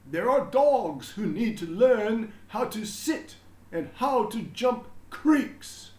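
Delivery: a beaming principal proclaiming to everyone; proud headmaster announcing. A narrator's reading. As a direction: announce it grandly to the whole school. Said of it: "Mister Keene said".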